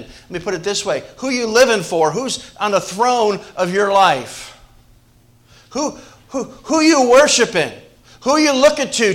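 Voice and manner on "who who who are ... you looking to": high, loud, pressing question; loud, high preaching challenge; rising preaching cry. Real, not acted. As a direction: build it up, calling out to the church. Preacher building to a peak.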